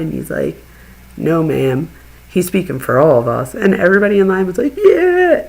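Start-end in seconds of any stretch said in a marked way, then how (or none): 1.2-3.5 lightly voiced firm veteran, louder; combat vet. Combat vet correcting the woman in line, lightly acted firmness. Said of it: "this combat, combat vet comes out of line". 4.8-5.5 high, loud retold cheer; crowd cheer. Everybody in line backing the vet, loud cheer.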